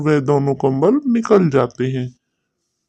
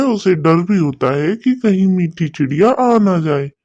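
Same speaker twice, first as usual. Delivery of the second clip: plain reading voice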